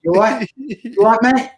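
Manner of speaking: put-on a cheery London accent greeting a mate. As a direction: ham up a chirpy London accent. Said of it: "[name] has a very good London accent".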